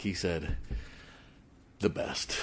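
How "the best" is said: voiceless awe; boy after his first pizza bite; whispered. Retold or imitated. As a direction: breathe it out in wonder. Boy with mouth full, breathy.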